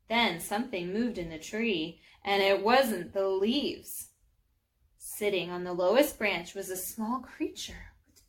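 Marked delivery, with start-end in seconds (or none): none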